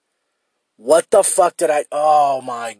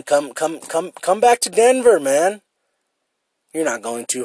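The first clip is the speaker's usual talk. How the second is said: usual speaking voice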